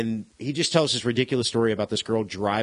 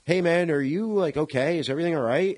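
slightly hammed-up check-in, higher pitch; imitated concerned bandmate; 6 semitones higher than usual. Retelling bandmates finding [name] rocking, mildly worried voice. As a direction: lift the voice as if carefully checking on a friend. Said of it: "everyone comes over and is like".

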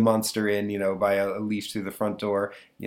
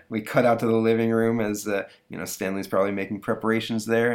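ordinary talk

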